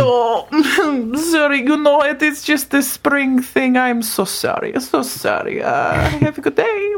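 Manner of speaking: a hint of an embarrassed creature; shedding creature apologizing to the worker; apologetic Frosty.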